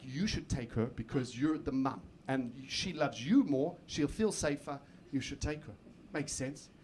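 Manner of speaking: mock soft coaxing. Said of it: "I try to use emotional blackmail on her".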